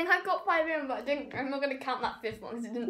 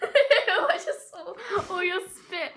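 laughing; 5 semitones higher, 8 dB louder, much slower than usual. Real, not acted. Laughing at spit, slow.

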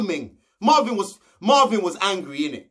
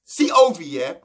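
ordinary talk

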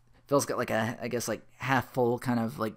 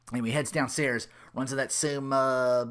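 usual speaking voice

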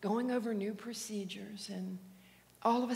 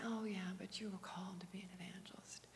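whisper; 11 dB quieter than usual. Retold or imitated. Quoted remark whispered fast.

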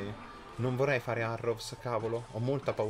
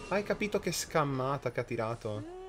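normal talking voice

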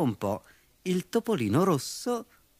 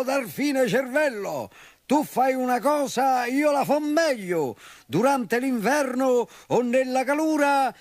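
much higher, louder bragging bull; booming bull boast; 11 semitones higher, 4 dB louder than usual. A narrator's reading. Bull bragging to the rhino, loud proud shout. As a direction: shout the brag like a show-off bull. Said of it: "gridò forte".

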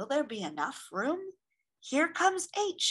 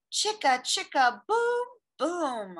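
dramatic; 6 semitones higher, 3 dB louder than usual. Acted. Gasp, dramatic fall.

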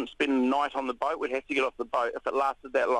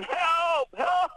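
calling for help; 13 semitones higher than usual. Retold or imitated. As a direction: yell for help from the reef. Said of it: "I just started going".

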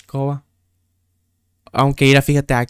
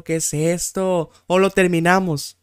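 normal talking voice

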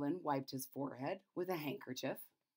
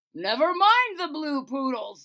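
loud, higher royal dismissal; king dismissing the Chamberlain, loud; impatient king; 7 semitones higher, 12 dB louder than usual. Acted. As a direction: brush it aside like a king. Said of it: "said the king".

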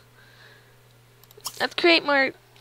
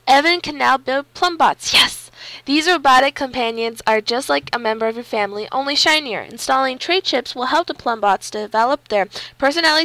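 loud, emphatic on-screen text read; loud game-message read; 9 dB louder than usual. Real, not acted. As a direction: read the pop-up out loudly, like an announcement. Reads game message aloud.

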